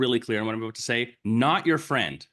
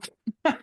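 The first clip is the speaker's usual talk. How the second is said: laugh. Real, not acted. Laughing at own point.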